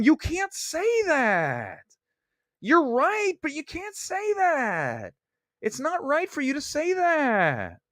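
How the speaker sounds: high, drawn-out prissy scolding voice; mock scold. Mocking Film Twitter critics who scold him, high prissy whine. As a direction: parody a fussy online scold, stretching each word. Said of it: "They go".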